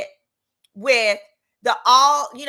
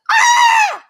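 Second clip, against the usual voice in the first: sudden high shriek, much louder; shocked scream; 12 dB louder than usual. Retold or imitated. She finds his record online, high shocked scream. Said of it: "and I'm like".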